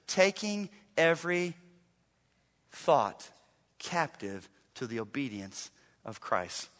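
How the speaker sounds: slow, hushed scripture read. Real, not acted. Pastor reading the verse to the congregation, slow and hushed. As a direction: read the verse slowly and softly.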